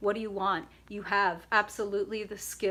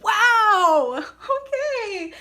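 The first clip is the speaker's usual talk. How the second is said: much higher, louder wow into a laugh; amazed wow; 12 semitones higher, 12 dB louder, much slower than usual. Real, not acted. Reader turns a card for Aries, amazed wow and laugh. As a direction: gasp with delight at the card.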